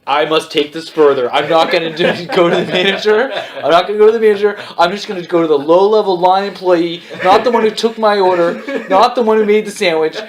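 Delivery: high-pitched, hammed-up righteous complaint; mock indignant customer. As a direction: build a petty grievance into a grand speech. Acted customer escalating a complaint.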